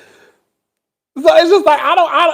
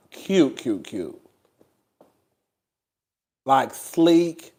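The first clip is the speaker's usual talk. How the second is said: dreamy; 10 semitones lower, 6 dB quieter than usual. Real, not acted. Swooning over an apartment.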